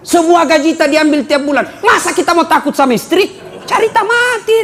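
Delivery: half-shouted joking grievance; a playful shout about the salary. Real, not acted. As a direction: rant it to the crowd for laughs. Joking complaint about wife.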